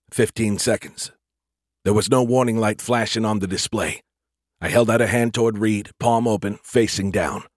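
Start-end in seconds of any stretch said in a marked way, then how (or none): none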